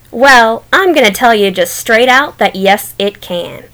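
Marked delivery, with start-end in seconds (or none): none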